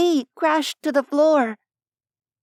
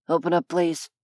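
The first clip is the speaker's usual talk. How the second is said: lower, businesslike hotel manager; muffled male voice; 6 semitones lower than usual. Acted. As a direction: through a closed door, lower. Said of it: "came a muffled male voice".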